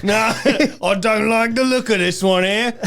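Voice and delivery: high, loud mock Cockney challenge; Cockney bouncer. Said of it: "Cockney British".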